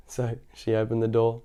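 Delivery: ordinary delivery, nothing marked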